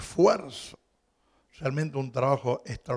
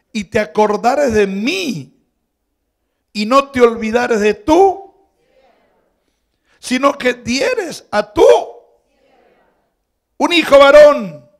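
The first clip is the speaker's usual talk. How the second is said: fervent prayer; 6 semitones higher, 11 dB louder, slower than usual. Acted. Preacher building Hannah's prayer.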